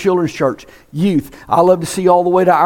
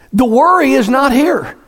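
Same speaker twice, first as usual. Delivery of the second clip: normal talking voice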